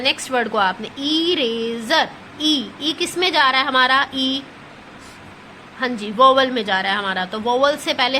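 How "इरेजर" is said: "इरेजर" very slowly, with a long opening ee; sounding it out. Shown as a demonstration. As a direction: stretch "इरेजर" syllable by syllable. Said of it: "इसको बोलना है". ("इरेजर" को ई खींचकर धीरे बोलें)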